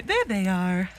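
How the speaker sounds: ordinary talk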